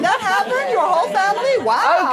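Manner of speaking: breaking into laughter in a mock airhead voice; Tracy impression. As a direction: squeak it like a dim helpline girl. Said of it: "Hello, Samaritans".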